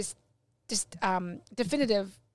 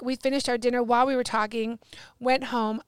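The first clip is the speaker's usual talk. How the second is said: usual speaking voice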